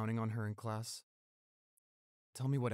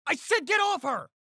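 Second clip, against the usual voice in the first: angry shout; 12 dB louder than usual. Acted. Confronting attackers of girlfriend.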